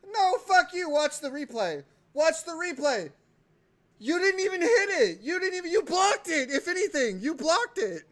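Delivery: high, loud, protesting; heated argument. Real, not acted. Disputing the play with Nick, heated loud protest. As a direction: argue the call hard.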